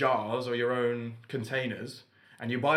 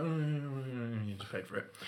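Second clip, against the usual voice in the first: soft, mumbled mock complaint; grumbling dad impression; 8 dB quieter than usual. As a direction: imitate a dad muttering in protest. Acts out dad's grumbling reaction.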